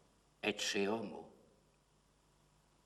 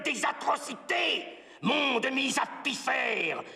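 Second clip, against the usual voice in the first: high-pitched, impassioned shouted litany; horrified shouting; 13 semitones higher than usual. Acted. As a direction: cry out each horror at full pitch. Climactic litany of atrocities. (litanie criée, aiguë, pleine d'épouvante)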